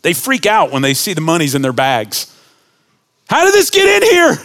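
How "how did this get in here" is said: high, loud frantic cry of alarm; panicked brothers. Retold or imitated. Brothers finding money in their sacks, alarmed.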